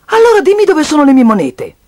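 high, loud insistence; insistent demand. Acted. Child demands coins from piggy bank, insistent.

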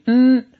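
"嗯" as a held front-nasal n; front nasal sound. Shown as a demonstration. Teacher adds the final sound, "嗯" as front nasal n. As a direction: hum "嗯" as the n ending. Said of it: "再加前鼻音".

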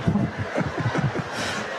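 a soft breathy laugh, almost silent; quietly laughing to himself. Real, not acted. Laughing at his own joke.

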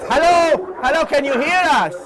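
calling out. Real, not acted. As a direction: get heard over the bad line. Shouting down a bad phone line.

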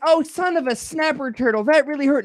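high-pitched mock outburst of pain; mock pained exclamation. Repeating the non-swearer's toe-stub cry, mock pained. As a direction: play a squeaky-clean cry of hurt. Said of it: "they'll stub their toe and they'll go".

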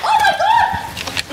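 high, drawn-out gasp of disbelief with a laugh; shocked laugh. Real, not acted. Housemates see him storm out.

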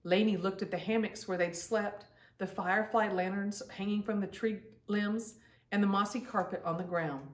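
ordinary delivery, nothing marked

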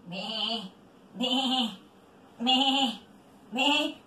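low, separate sheep-like bleats; animal bleating. Shown as a demonstration. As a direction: bleat low, one short call at a time. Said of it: "vamos a hacer los sonidos". (balido grave de oveja)

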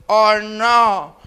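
put-on lightly slurred drunken tag question; mock-drunk "¿O no?"; drunk grandfather. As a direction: hang on the words like a stuck drunk. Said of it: "Ya bien pedo, ya es que te trabas".

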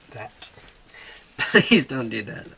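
ordinary talk, nothing marked

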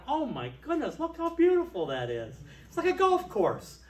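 high, rising admiring exclamation; delighted gasp. Retold or imitated. Driving past the church lawn, gushing with delight. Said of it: "I drove past and I went".

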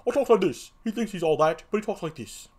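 cocky clown, lightly put on; he thinks he's all that; first clown voice. Shown as a demonstration. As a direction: test a self-satisfied character voice. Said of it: "I think I'll give him the voice".

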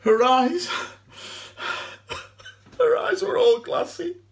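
high, loud sobbing breakdown; anguished crying. Acted. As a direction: wail it through tears, haunted by the sight. Breaks down recalling her body.

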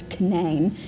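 Canaan as kuh-NANE, stress on the last syllable; West Virginia pronunciation. Shown as a demonstration. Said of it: "it's pronounced Canaan".

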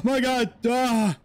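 real jump-scare shriek; startled scream. Real, not acted. Gamer spooked by the game, screaming.

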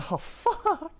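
high, loud yelp of dismay; real startled yelp; shocked high yelp. Real, not acted. Reaction to a game event.

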